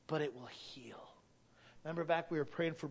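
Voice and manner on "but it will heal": soft whisper of comfort; whispered reassurance. Real, not acted. Comforting the grieving congregation, a soft whisper. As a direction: breathe the promise to them gently.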